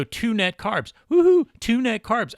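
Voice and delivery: high, hammed-up cheer with a loud whoop; mock celebration. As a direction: pretend to be thrilled by a tiny win. Mock celebration of two net carbs.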